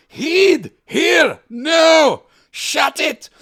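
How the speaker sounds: high, loud Scottish bark at the son; Scottish yelling dad. Retold or imitated. A Scottish father yelling at his big-headed son, loud accented shout. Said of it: "he just keeps yelling".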